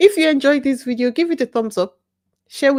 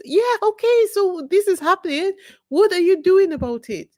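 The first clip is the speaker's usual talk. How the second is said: high, pointed, pressing challenge; impatient challenge; 8 semitones higher than usual. Acted. Speaker challenges people who only talk about things, impatient. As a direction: press them for action, pitch lifted well up.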